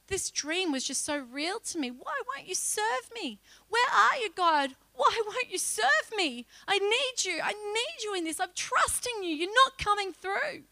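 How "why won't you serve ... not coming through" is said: pleading prayer. Retold or imitated.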